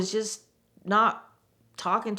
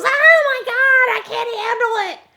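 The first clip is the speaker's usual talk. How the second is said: panicked scream; 10 dB louder than usual. Retold or imitated. Boss freaking out at the sight of her cuts, panicked scream. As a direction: act out a hysterical freak-out at full volume.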